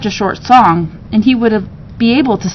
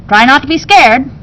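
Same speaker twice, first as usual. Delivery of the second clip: a touch louder, cautioning; lightly warning host; 4 semitones higher, 5 dB louder than usual. Acted. Ebenezer cautioning the parrot before the door, mildly warning. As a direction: warn him kindly but firmly.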